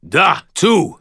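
loud, booming reply. A narrator's reading. Rescuer answering from the ladder, booming. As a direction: boom it back as a big Russian. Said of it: "his deep voice booming".

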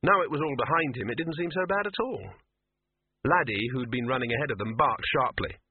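usual speaking voice

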